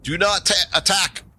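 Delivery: high-pitched goblin command; high goblin voice, slowing on the announcement; goblin calling out. Acted. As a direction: call out from inside the fort in a high voice. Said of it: "You hear a voice coming from inside".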